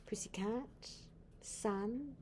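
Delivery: plain reading voice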